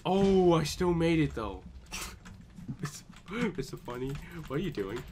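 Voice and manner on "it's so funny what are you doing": ordinary delivery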